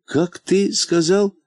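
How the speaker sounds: high, slow, amazed question; baffled by the odd saying; astonished. Acted. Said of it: "поразился Полботинка".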